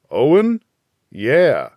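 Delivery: high, proud and bright lift on the name; a proud father lighting up at his son's name; beaming with pride. A narrator's reading. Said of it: "Mac beamed".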